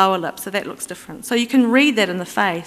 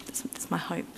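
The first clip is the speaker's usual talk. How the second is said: whispering; 13 dB quieter than usual. Real, not acted. Whispered joking aside to audience.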